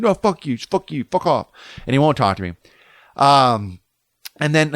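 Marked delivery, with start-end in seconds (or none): 0.0-1.5 angry dismissal. Retold or imitated. Angry surfer refuses to talk.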